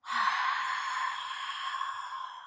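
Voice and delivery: breathy ocean-like exhale through throat; Ujjayi breath. Shown as a demonstration. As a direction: fog up a mirror with your breath. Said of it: "Can you hear that sound?".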